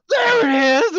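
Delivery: very high, loud excited cry; imitated triumphant shout. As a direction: yell it like the long-awaited moment arrived. Guy's payoff outburst at the shooting.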